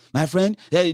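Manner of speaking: ordinary talk, nothing marked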